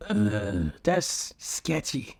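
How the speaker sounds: slow, grossed-out ugh; mock disgust; disgusted aside. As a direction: drag out the disgust. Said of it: "And I would be like".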